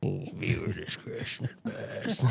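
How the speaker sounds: slow, low, hushed spooky warning; haunting creepy voice. Acted. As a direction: drag it out softly like a haunting ghost. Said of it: "like you're haunting someone's children".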